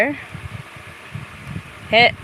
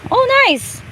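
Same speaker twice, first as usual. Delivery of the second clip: high excited squeal. Real, not acted. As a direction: squeal with joy. Excited at new move.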